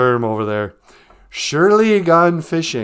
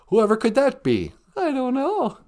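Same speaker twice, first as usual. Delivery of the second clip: usual speaking voice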